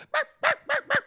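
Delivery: high yappy barks; imitated dog barking. Host imitates the neighbor's dog barking high. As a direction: yap like a small dog. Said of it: "you heard her dog go".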